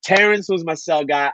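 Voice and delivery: normal talking voice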